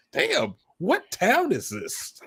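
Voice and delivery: drawn out like you're smitten; a smitten guy stretching the words; impressed mock reaction. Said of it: "it's just like".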